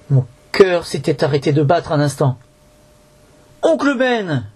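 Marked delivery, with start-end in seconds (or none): 3.6-4.4 relieved cry. A narrator's reading. Boy recognises his uncle, high cry. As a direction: call out in sudden relief. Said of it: "m'écriai-je".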